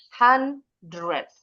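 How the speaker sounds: HUNDRED split as "han, dred"; r dulu baru e. Shown as a demonstration. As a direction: say HUNDRED in two parts, r before e. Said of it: "R-nya dulu, baru E". (HUNDRED dieja bunyi "han-dred")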